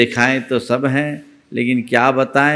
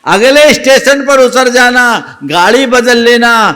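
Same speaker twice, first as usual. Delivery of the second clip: higher, louder insistent advice; emphatic advice; 11 semitones higher, 8 dB louder than usual. A narrator's reading. Old woman urging the youth to change trains, in a loud high insistent voice. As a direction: insist firmly that he switch trains.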